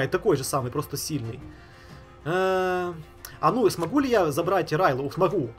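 usual speaking voice

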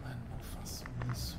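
hushed, pleading whisper; library whisper. Real, not acted. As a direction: beg softly so nobody hears. Hiding, pleading quietly.

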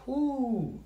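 high, drawn-out "whoo"; impressed whoop. Acted. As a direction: let out a high whoo of awe. Marvels at girlfriend's important father.

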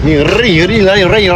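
slow, rolled trill on each รี; rolled r demo. Shown as a demonstration. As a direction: roll the r hard in รี รี รี รี. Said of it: "มันต้องใส่ลิ้นเนี่ย".

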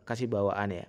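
normal talking voice

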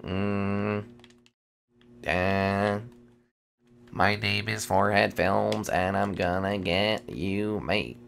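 slow, low creepy taunt; a hint of a menacing villain; mock creepy voice. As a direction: play the lurking stalker, drawn out. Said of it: "He's like".

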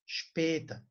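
später slowly with a Şp start, Şpeta; German später. Shown as a demonstration. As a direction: stretch später and open it with Şp. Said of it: "şıp sesi çıkıyor". (später'i yavaşça Şp ile: Şpeta)

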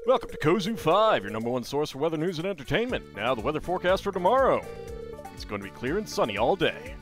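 fast, loud radio announcer; weather announcer. Acted. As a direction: rattle off the forecast quickly. Reads TV weather report.

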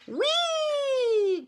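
very high, long sliding squeal; gleeful cry. A narrator's reading. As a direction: squeal it all the way down the slide. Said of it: "cried Beaver".

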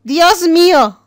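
loud startled "¡Dios mío!"; surprised outcry. Real, not acted. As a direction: blurt it out in shock. Sudden surprise in game. (exclamación fuerte de sorpresa)

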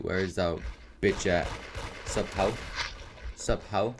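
ordinary talk, nothing marked

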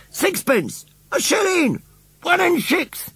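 very high-pitched bidding cries; very excited. A narrator's reading. Auction crowd calls out bids, very excited. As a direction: call each bid excitedly, right up high. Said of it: "in a very excited way".

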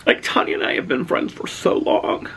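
choked up, high voice breaking into breath; real teary-eyed. Real, not acted. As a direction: talk through rising tears. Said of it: "I'm gonna get teary-eyed again".

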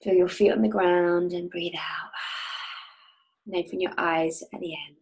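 calm guide leading a visualization; guided meditation. Acted. Leads a guided oil meditation.

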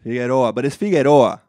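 "Figueroa" in a Spanish accent, fee-geh-RO-ah; emphatic Spanish pronunciation of "Figueroa". Shown as a demonstration. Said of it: "How do you say that with an accent?".

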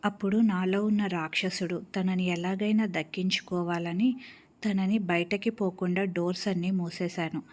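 ordinary delivery

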